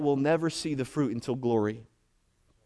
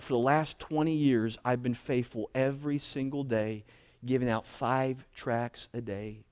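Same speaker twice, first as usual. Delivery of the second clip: subdued, tearful retelling; 4 semitones lower, 6 dB quieter than usual. Retold or imitated. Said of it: "breaks down in tears".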